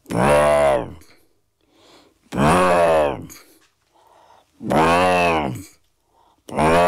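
imitated didgeridoo drone; strange didgeridoo noise. A narrator's reading. Didgeridoo sounding as Dad shakes the boy, strange drone. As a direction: hold droning honks, the last one long. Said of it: "a strange noise once again".

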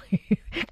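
short high chuckle; brief amused laugh. Real, not acted. Se ríe del padre enfadado. (risa corta y aguda)

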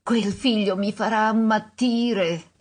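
usual speaking voice

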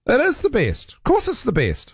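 high, eager agreement, up in pitch; emphatic agreement. Real, not acted. Host cheering a caller's pride in Kiwi butter, high and eager. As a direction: pitch it high, all-in on the praise.

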